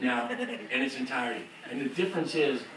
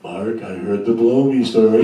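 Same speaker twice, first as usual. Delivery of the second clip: God's voice; 6 semitones lower, 11 dB louder than usual. Retold or imitated. God speaking to the songwriter, lower and louder. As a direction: lower and louder booming voice from above. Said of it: "God's voice".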